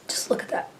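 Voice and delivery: hushed, admiring whisper. Real, not acted. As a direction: breathe it out softly in awe. Admiring polish up close.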